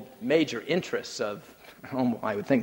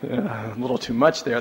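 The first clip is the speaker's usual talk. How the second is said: ordinary delivery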